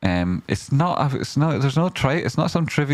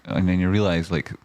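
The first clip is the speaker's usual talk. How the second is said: ordinary talk, nothing marked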